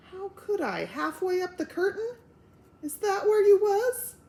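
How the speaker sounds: high, anxious rising voice; alarmed questions. Acted. Wife alarmed at husband's danger, high anxious questions.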